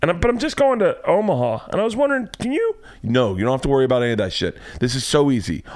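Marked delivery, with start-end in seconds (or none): 0.0-2.8 much higher, pleading voice; hammed-up embarrassed guy; awkward patient. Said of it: "tell them".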